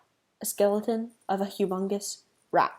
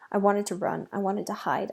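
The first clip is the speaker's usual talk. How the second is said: ordinary delivery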